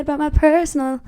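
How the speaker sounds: words broken by a hiccup. Real, not acted. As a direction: hiccup mid-word. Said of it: "I'm hiccuping and, like, burping".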